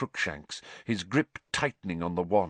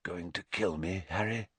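hoarse low whisper; 3 semitones lower, 8 dB quieter than usual. A narrator's reading. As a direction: breathe it out barely audible. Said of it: "he whispered".

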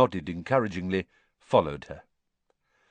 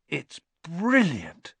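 slow, delighted and higher; happy; 7 semitones higher, much slower than usual. A narrator's reading. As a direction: savour it with pure delight. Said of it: "said Harry happily".

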